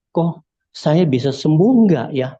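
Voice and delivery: slow, lightly imitated moaning voice. Sick in-law wondering aloud about recovery, imitated moan. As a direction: draw it out like a patient moaning. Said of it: "suaranya rintih".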